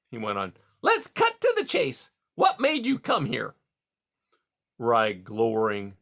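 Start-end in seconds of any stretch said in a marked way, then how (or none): 0.8-3.6 much higher, louder hearty voice; hearty beaver. A narrator's reading. Beaver Mister Conrad greeting caged Rye, high and hearty. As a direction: boom out a jovial salesman beaver.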